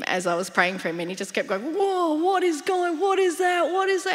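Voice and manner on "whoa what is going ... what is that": freaking out. Retold or imitated. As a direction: act overwhelmed, pitch way up and words stretched. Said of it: "he was freaking out".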